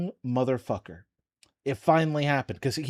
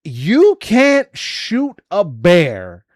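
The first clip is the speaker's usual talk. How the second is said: angry ranting, getting louder; heated rant; 5 semitones higher, 8 dB louder than usual. Real, not acted. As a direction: build into an outraged tirade. Furious rant at the shooter.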